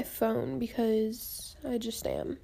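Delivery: whispered aside; whispering. Real, not acted. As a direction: whisper while scrolling phone. Aside while checking phone.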